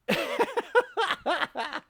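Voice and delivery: high laugh. Real, not acted. Laughing at wife fight.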